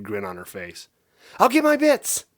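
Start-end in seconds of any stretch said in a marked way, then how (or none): 1.4-2.2 very high, loud gleeful yell; overjoyed shout; excited shout. A narrator's reading. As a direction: call out as she dashes off. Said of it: "she shouted".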